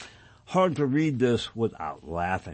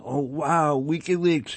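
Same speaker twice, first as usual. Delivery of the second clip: higher-pitched imitation of naive admirers; mock amazement; 5 semitones higher, slower than usual. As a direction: imitate a gullible, wowed fan. Mimics gullible admirers of WikiLeaks.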